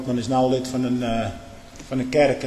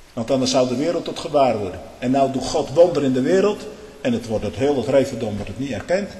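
ordinary talk

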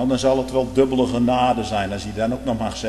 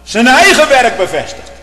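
loud, higher fervent preaching that builds to a shout; preaching at full force; 7 semitones higher, 10 dB louder than usual. Real, not acted. Preacher exhorting his congregation, preaching at full force. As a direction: thunder it from the pulpit.

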